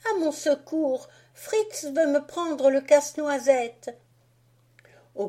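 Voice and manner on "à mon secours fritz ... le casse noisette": put-on high, louder girl's plea; Marie calling for help. Marie to her father about Fritz, high pleading cry. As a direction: call for rescue, near tears.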